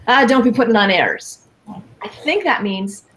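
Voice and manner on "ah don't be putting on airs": lightly voiced scolding Irish grandma; a hint of an old Irish granny telling you off. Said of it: "So my grandma used to say".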